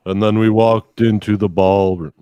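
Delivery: loud, declaimed rock-poet voice; intoned poetry impression. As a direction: recite it like a rock singer's spoken poetry.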